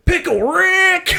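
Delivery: high, slow cartoon yell; Pickle Rick shout. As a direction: belt it like the cartoon catchphrase. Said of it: "I'd just go".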